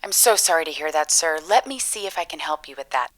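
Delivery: ordinary talk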